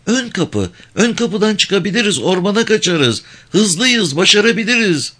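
put-on high-pitched child urging her mother; desperate little girl. A narrator's reading. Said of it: "Küçük kız çaresizlik içinde". (çaresiz, tiz çocuk sesi)

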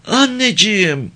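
put-on high, drawn-out little-girl plea; yalvaran küçük kız. A narrator's reading. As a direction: call to mother slowly in a high child's voice. Child pleading with mother in story. (tiz, uzatarak yalvaran çocuk sesi)

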